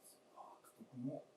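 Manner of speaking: slow breathy whisper, very soft. Acted. Husband answering his wife's charge in a slow whisper. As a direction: breathe it out slowly, barely there.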